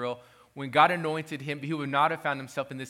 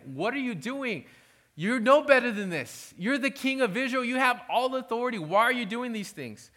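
higher, pleading voice of Samuel; 5 semitones higher than usual. Retold or imitated. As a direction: plead with Saul as a grieved prophet. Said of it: "Samuel's even making a plea to him".

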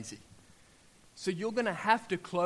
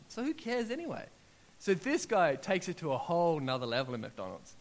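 ordinary delivery

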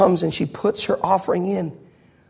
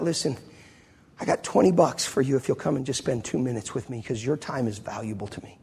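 tender; 4 semitones lower, 7 dB quieter than usual. Retold or imitated. Speaking gently to waitress.

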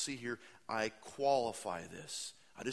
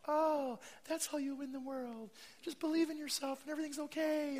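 very high, squeaky mock cheer; high, silly parody of a kids' cartoon. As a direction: squeal it like a sugary cartoon sidekick. Mocking cartoon message.

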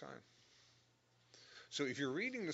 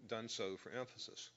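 normal talking voice

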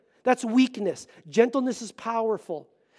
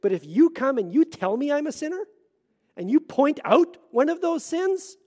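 usual speaking voice

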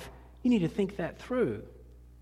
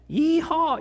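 high, loud hillbilly whoop; 11 semitones higher, 5 dB louder than usual. Pretend. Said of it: "and I sound".

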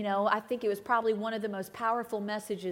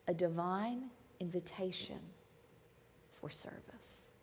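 hushed emphasis; 3 semitones lower, 11 dB quieter than usual. Real, not acted. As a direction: drop to a near whisper on divine invitation for service. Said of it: "did you notice it's an invitation".

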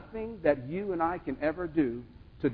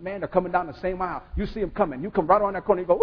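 normal talking voice

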